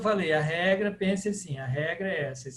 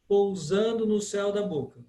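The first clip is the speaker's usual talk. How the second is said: usual speaking voice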